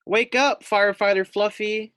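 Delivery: high, loud wake-up call; bright sing-song wake up. Acted. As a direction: rouse a sleeping pig cheerfully. Voice wakes the sleeping pig.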